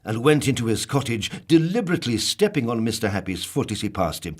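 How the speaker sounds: ordinary delivery, nothing marked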